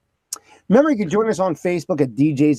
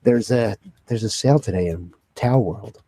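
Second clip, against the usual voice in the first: store announcer voice; 8 semitones lower than usual. Retold or imitated. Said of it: "Attention Kmart shoppers".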